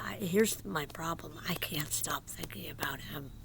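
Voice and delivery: soft whispered confession. Real, not acted. Admitting to viewers she can't stop thinking, whispered. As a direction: breathe it out secretly.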